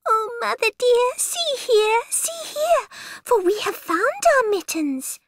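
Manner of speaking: very high squeaky kitten voice; excited kitten voice. Acted. Kittens happily report to mother.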